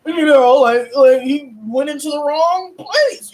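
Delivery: mock a slow, lost man fumbling for words; confused and lost. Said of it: "he was confused and lost".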